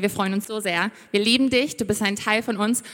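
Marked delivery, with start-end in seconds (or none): none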